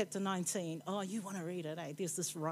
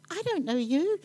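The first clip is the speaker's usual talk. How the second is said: imitating a narrator doing a little kid, higher and a bit louder; 8 semitones higher, 5 dB louder than usual. Preacher imitates audiobook reader voicing demon, light little kid voice. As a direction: mimic the audio reader's small-child voice, lightly. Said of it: "he changes his voice ... sounds like some little kid".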